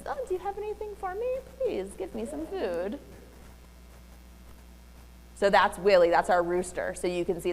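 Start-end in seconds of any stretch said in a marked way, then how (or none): 0.1-3.0 put-on high, fast baby talk; dog baby voice. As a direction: coo it like baby talk to a pet. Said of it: "I use my dog baby voice".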